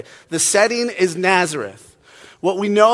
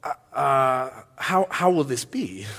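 hesitant question; 4 semitones lower, 4 dB quieter, slower than usual. Acted. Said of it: "this is what Mary says".

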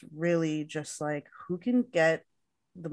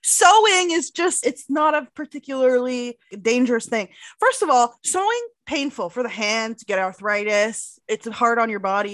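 loud, high-pitched indignant rant; furious and raised, arguing hard; outraged; 5 semitones higher, 6 dB louder than usual. Real, not acted. Outraged rant about sewing danger.

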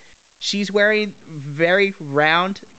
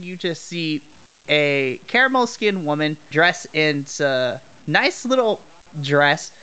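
normal talking voice

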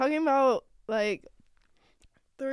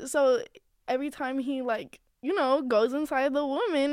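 usual speaking voice

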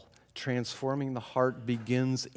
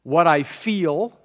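slow, louder repeat of What I feel; slow emphasis; 6 dB louder, slower than usual. Real, not acted. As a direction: stretch each word for weight. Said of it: "Oh, that's key".

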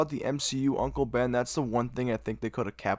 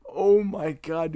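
heartbroken; 7 semitones higher, 6 dB louder, slower than usual. Real, not acted. Heartbroken fan reaction to death scene.

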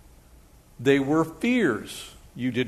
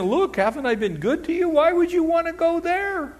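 pleading father. Retold or imitated. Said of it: "The father's saying".

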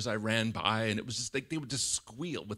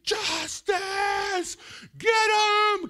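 high, drawn-out mimicked shriek; hammed-up kid's battle cry; kids demanding justice; 6 dB louder, much slower than usual. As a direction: scream it like a child out for payback.

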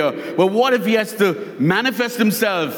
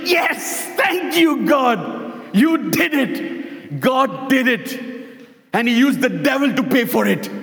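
high, excited thanks, retold; joyful praise; 5 semitones higher than usual. Old lady answering the neighbor, high and excited. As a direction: cry out thanks at a high pitch. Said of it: "And she's like".

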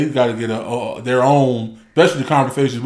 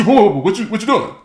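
startled; 7 semitones higher than usual. Retold or imitated. Husband voices startled wife.

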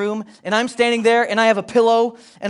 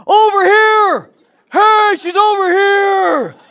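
loud call; 13 semitones higher, 9 dB louder, much slower than usual. Retold or imitated. Dad calling out in crowd.

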